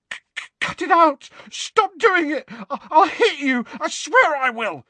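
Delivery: high, loud stammering panic; frantic frightened yelling; panicked threat. A narrator's reading. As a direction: shout it in terror, tripping over words. Terrified Dudley threatens Harry in the dark.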